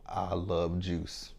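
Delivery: slow, drawn-out I love juice; said slowly. Shown as a demonstration. Vlogger demonstrates the phrase to viewers, slow. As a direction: linger on each word, especially LOVE. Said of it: "you kinda say it slow".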